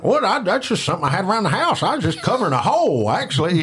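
high, fast innocent excuse voice; imitating Joe shrugging off the swastika; Joe impression. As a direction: play dumb in a quick high voice. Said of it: "That's exactly what he sounded like".

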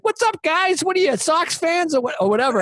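high-pitched mock-chummy hailing; imitated cocky greeting. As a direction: play the drunk friend greeting strangers. Imitating friend hailing Boston strangers.

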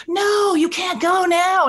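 high, slow, drawn-out whine of protest; mock pleading protest. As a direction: stretch it out like partygoers begging her to stay. Party people protesting her leaving.

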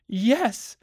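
high, loud, tearful yes; frantic. A narrator's reading. Wife nods watery-eyed at her husband's question, frantic. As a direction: burst out in eager agreement. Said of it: "A frantic, watery-eyed nodding".

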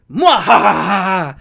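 slow evil villain cackle; hammy villain laugh. Acted.

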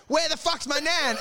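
high-pitched indignant demand; hammed-up angry question; mock outrage. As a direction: play the outraged grandson, pitch up. Acted grandson demands his missing nan.

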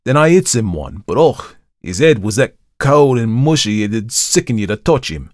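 a spooked Cockney lad telling it loud; uneasy Cockney manservant; Cockney servant. Acted. Thomas tells his ghastly tale.

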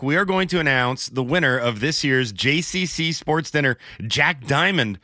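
ordinary delivery

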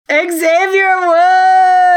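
loud, high, slow drawn-out name call; excited shout. Real, not acted. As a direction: announce him like a ring announcer. Hyping the wrestler's entrance.

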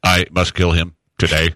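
low, stilted dubbed-movie voice; bad kung fu dub. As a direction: imitate a badly dubbed action film. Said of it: "sounds like a nineteen seventies".

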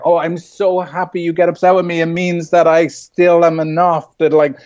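a hint of a cheery, sing-song partner; mock delighted spouse. Spouse gushing over being late, lightly mock-delighted. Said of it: "your spouse should be like".

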